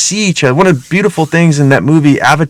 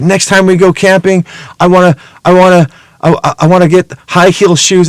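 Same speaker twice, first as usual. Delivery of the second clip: higher, eager stammering child imitation; tripping over repeated words with excitement; excited little daughter; 4 semitones higher than usual. Daughter planning a camping trip, imitated as excited. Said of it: "she gets all excited".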